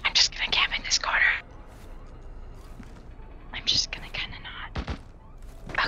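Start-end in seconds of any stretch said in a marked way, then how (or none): 3.5-5.9 hushed whisper while hiding. Real, not acted. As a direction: whisper it so nobody hears. Hiding in a corner, camping.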